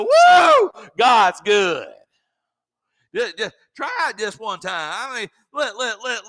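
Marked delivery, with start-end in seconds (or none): 0.0-1.9 a huge high Woo, then God's good belted; joyful shout. Shown as a demonstration. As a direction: whoop it out to the whole church. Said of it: "just look at somebody and go".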